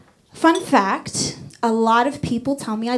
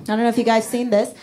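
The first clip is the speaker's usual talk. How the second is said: ordinary talk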